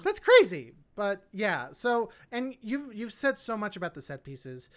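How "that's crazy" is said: emphatic outrage. Real, not acted.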